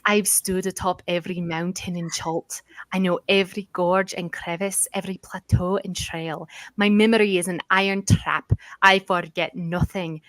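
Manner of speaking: boastful dwarf in an attempted Scottish accent. Dwarf guide pitching his services to adventurers, attempted Scottish accent. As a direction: do a classic Scottish dwarf, proudly declaiming. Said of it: "try and do a Scottish accent".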